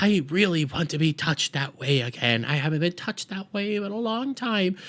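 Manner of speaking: soft, wistful put-on old lady; old lady impression. Old lady longing to play piano, soft put-on elderly voice. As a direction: start soft and lift into a yearning quaver. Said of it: "old lady goes like".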